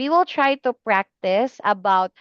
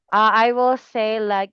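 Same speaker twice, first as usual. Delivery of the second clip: usual speaking voice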